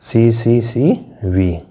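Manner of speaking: C, V, C drawn out one letter at a time; letter-by-letter teacher spelling; spelled out slowly. Shown as a demonstration. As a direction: spell the pattern slowly for students. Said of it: "हमारा pattern बन गया".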